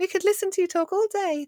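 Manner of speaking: very high mock-adoring voice; sing-song pretend admiration, high; imagined children's gushing. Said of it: "my children never say that to me".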